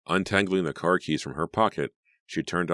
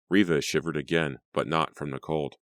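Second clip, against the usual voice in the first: ordinary delivery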